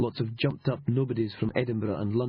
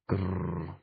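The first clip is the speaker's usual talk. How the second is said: a low, rumbling grr; low growl; 5 semitones lower, 3 dB quieter than usual. Acted. Sergeant growls thinking of the trench-coated legions, low growl. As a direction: growl under your breath like an annoyed dog.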